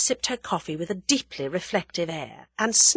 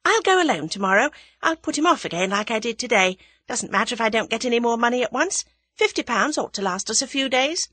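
lightly voiced young woman, quick and higher; young woman, calmly planning; 6 semitones higher, 4 dB louder, faster than usual. A narrator's reading. Young woman outlining tomorrow's plan, calm but brisk. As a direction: set out the plan briskly, unruffled. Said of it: "Tuppence went on calmly".

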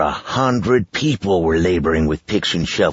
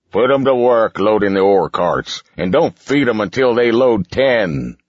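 louder, gruff boss commands; barking villain orders; 5 semitones higher, 4 dB louder than usual. Acted. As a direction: bark the orders at his men.

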